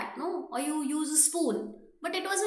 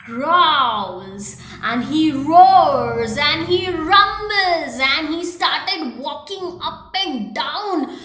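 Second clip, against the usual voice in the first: loud slow narration; 9 dB louder, slower than usual. A narrator's reading. Narrating bear's roaring.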